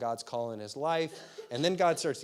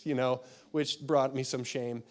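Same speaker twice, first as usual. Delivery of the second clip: ordinary talk, nothing marked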